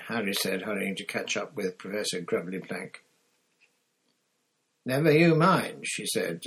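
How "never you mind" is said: brisk, dismissive teacher's reply; curt brush-off. A narrator's reading. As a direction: close the topic firmly. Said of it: "as though she thought he was being nosy".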